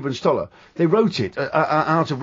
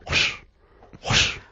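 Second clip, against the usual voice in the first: [name] whoosh; 5 dB quieter than usual. Shown as a demonstration. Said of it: "the second sound he taught me".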